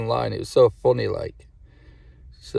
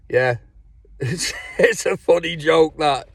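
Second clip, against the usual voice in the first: laughing; 5 semitones higher, slower than usual. Real, not acted. Laughs at own joke.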